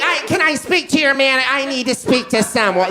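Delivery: high, demanding manager request. Retold or imitated. To the robber, a high entitled demand for a manager. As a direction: ask for the manager, affronted. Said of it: "the cuntiness in our voice".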